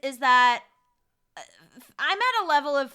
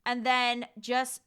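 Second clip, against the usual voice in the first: ordinary talk, nothing marked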